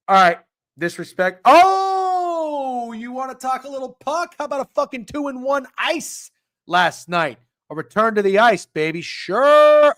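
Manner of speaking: high, loud hyped-up hollering; excited. Real, not acted. Hyped about last night's bets.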